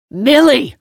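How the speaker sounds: loud high furious yell; shouting a name. A narrator's reading. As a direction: bellow the name. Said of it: "He's still calling my name".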